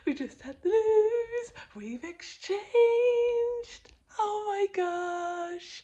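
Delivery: high, loud, thrilled squeal; excited. Real, not acted. Telling viewers the house exchange happened, squealing excitedly. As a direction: burst out with the big news. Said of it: "I'm so excited".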